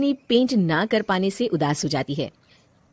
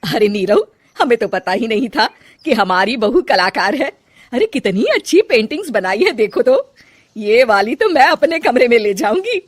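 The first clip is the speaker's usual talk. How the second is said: louder, excited admiring voice; delighted mother-in-law; 3 semitones higher, 6 dB louder than usual. Acted. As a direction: show off the bahu's art. (खुश होकर तारीफ़)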